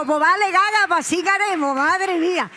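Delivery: high, loud put-upon answer; mock exasperated reply. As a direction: give in loudly, half laughing at it.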